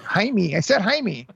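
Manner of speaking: Jaime ending in "mee", not "meh"; mispronounced name. Retold or imitated. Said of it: "You said Jaime".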